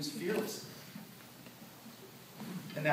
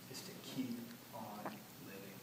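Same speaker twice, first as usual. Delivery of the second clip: hushed; 4 semitones lower, 13 dB quieter than usual. Retold or imitated. Father's advice at eulogy's end.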